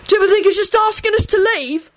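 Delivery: high-pitched, mocking disbelief; incredulous. A narrator's reading. Sirius questions the gang over their turf, incredulous. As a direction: ask it high, as if you can't believe it. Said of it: "barked out incredulously".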